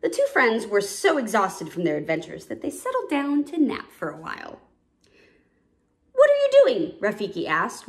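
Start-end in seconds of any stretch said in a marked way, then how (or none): 6.1-7.0 high, loud, startling question; abrupt wake-up call. A narrator's reading. Old Rafiki waking two napping cubs, abruptly. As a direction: jolt the sleepers awake. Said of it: "waking the two cubs up abruptly".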